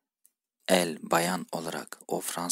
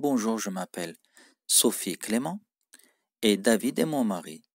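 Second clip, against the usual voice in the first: usual speaking voice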